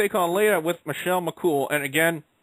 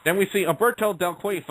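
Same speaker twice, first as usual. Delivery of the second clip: ordinary talk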